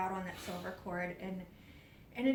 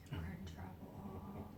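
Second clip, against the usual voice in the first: hushed dread; 11 dB quieter, much slower than usual. Retold or imitated. Dread on seeing the figure.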